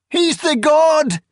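very high, loud, drawn-out scream; frantic high wail; shriek. A narrator's reading. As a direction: shriek it in desperate protest. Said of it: "she shrieked".

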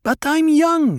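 high, loud insistence; repeated angry protest. A narrator's reading. Harah repeating her protest to Stilgar, high and loud. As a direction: say it again, just as high and loud. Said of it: "Hera repeated".

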